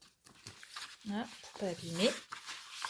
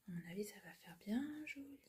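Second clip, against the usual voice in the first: whisper; 15 dB quieter, faster than usual. Real, not acted. Quiet aside.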